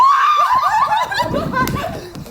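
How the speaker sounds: very high, loud bawdy cackle; whooping teasing laugh; shrieking laugh. Acted. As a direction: cackle at the bride-to-be. Nurse teases about husband.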